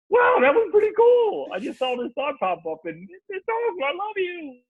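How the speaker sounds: very high, sing-song delight; delighted gushing. Acted. Husband sharing his silly thought with his wife, high and gushing. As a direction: gush it in a hammed-up high voice.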